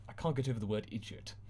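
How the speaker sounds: ordinary delivery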